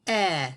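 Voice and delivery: "eh" as in elephant, short and open; short e sound. Shown as a demonstration. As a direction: model "eh" again for kids. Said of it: "Say it".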